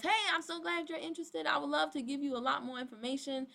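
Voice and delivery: perky imitation of a sales message; mock eager pitch. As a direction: imitate an over-keen recruiter gushing. Acts out recruit's eager pitch.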